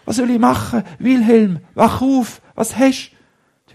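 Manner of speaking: panicked calling; boy shaking his unconscious friend awake; desperate plea. Acted. Trying to wake friend.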